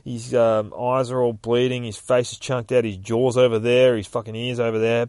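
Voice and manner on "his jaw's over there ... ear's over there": ordinary delivery, nothing marked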